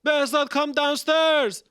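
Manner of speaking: high, loud call of a name from below the window; shouting up from the street. Retold or imitated. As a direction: call Behzad to come down, loud and urgent. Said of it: "start shouting".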